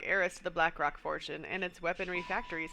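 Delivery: usual speaking voice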